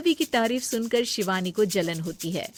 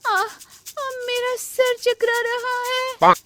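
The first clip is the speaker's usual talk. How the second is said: much higher, slow, hammed-up dizzy moan; faked dizziness; 5 dB louder, slower than usual. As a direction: overact the fainting spell. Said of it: "चक्कर आने का नाटक करने लगती है". (चक्कर आने का बढ़ा-चढ़ाकर नाटक)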